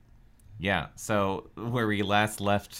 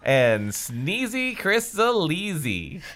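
showman intro; 7 semitones higher, much slower than usual. Acted. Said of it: "I'm workshopping it".

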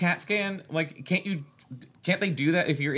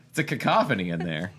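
plain reading voice

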